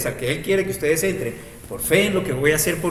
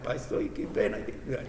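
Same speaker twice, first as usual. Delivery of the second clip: fretting mother; 4 semitones lower, 10 dB quieter than usual. Acted.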